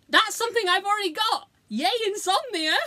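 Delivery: high-pitched, gleeful self-mockery; put-on delighted squeal of words; mock excitement. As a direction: cheer it in a high voice, tongue in cheek. Acted reaction to the lion's circles.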